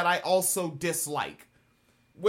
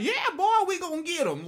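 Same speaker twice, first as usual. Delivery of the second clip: mock Southern accent; 12 semitones higher, faster than usual. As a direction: play a hammed-up Southern good old boy. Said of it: "it sounds like".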